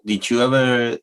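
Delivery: ordinary talk, nothing marked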